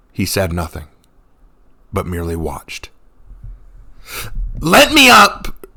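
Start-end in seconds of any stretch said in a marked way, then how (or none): 4.6-5.6 slow, high, loud demand. A narrator's reading. Revna, held down, demands to be let up. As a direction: order him off you. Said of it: "she finally demanded".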